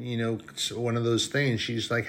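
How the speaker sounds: usual speaking voice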